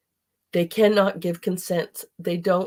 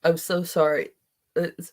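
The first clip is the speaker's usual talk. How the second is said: ordinary talk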